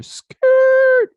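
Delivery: very high, loud vocal skid; screeching tire imitation. Man recreating a street accident, piercing tire screech. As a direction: squeal like braking tires.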